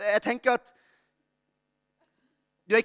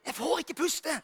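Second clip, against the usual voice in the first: mock whisper; 6 semitones higher, 6 dB quieter, slower than usual. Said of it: "Så hvisker han".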